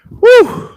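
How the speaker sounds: high, loud "woo"; excited whoop. Real, not acted. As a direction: let out a sharp, high whoop. Whoops while working.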